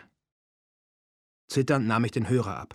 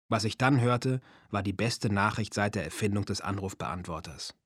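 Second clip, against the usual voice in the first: plain reading voice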